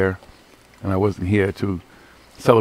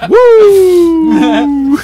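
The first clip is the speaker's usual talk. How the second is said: high, loud laughing whoop; Ric Flair woo; 13 dB louder than usual. Retold or imitated. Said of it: "They woo it all over you".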